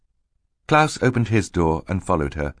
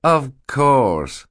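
higher, louder, over-agreeable; smooth; 8 semitones higher, 5 dB louder than usual. A narrator's reading. Stefano hides anger from Mister Poe, smoothly. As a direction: agree with put-on ease. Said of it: "answer smoothly".

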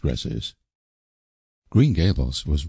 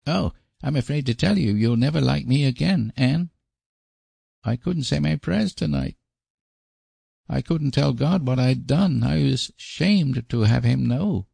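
put-on high-pitched child's voice, owning up; woeful high little-boy voice; Davy, miserable little boy; 9 semitones higher than usual. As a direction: play a small boy sorry for himself, high. Boy confesses to Anne.

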